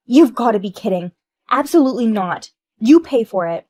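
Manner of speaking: loud flat-out refusal; angry refusal. Acted. Wife shouting that her friend should pay. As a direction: push the blame back hard.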